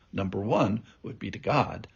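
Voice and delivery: ordinary talk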